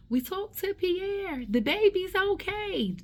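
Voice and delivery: a nurse calmly reassuring a patient, high and slow; gentle nurse voice. Retold or imitated. Nurse tells the waking patient the baby is fine, high and slow.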